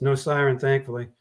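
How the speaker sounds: ordinary delivery, nothing marked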